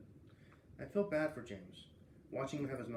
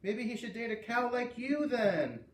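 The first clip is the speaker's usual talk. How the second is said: higher, slow taunting call; yelling after; 9 semitones higher, 5 dB louder, slower than usual. A narrator's reading. Mean girl yelling taunts down the hall. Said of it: "Lexi yelled after me".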